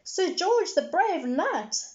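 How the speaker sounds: put-on slow high proud little girl. A narrator's reading. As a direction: announce it like a small girl. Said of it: "Peppa interrupted again".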